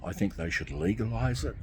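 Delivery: ordinary talk, nothing marked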